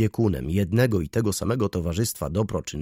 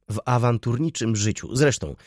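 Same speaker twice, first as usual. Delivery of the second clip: ordinary delivery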